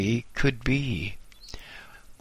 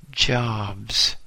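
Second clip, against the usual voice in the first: usual speaking voice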